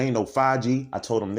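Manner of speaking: normal talking voice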